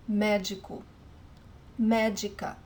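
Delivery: médico and médica with stress on MÉ, d as j; Portuguese pronunciation. Shown as a demonstration. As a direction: model médico, médica for learners.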